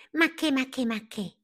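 high, rattled-off brush-off; dismissive lively caterpillar. A narrator's reading. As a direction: wave the idea away in a high little voice. Lively Palmira dismisses the idea.

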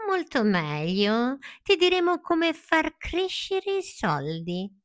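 high, slow coaxing fox; a hint of a sly fox. Fox tempting Pinocchio, slow and high. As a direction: tease the secret out slowly.

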